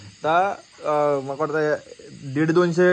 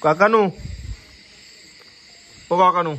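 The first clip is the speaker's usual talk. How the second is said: loud, higher call across the room; 4 semitones higher, 4 dB louder than usual. Real, not acted. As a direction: shout for the uncle. Calling the cook from afar.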